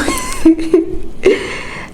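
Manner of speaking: very high giggling burst; high giggle. Real, not acted. Laugh at own ambition.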